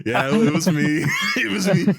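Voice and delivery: loud, high, laughing admission; laughing confession. Real, not acted. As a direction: crack up owning it.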